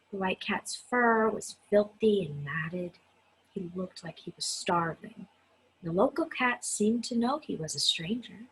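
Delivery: usual speaking voice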